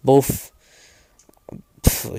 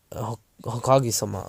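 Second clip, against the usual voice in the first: plain reading voice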